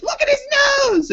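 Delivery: very high-pitched mom shriek; mock-outraged mom. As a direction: screech it like a tattling mom. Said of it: "And my mom's like".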